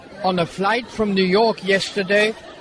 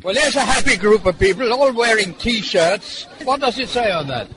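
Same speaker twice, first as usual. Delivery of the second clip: usual speaking voice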